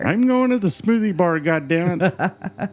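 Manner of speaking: high, loud comic insistence; mock defiance. Co-host insisting on a smoothie bar, mock defiance. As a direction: dig in loudly with hammed-up outrage.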